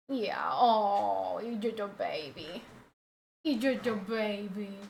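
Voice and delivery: soft cooing over a pet; tender doggy-talk. Real, not acted.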